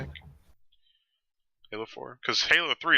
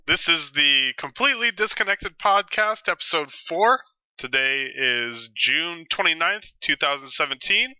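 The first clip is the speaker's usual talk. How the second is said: big, slow show-host intro; announcer voice; 6 semitones higher, 3 dB louder, slower than usual. Acted.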